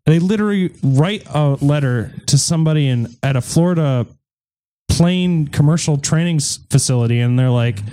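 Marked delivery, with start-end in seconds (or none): none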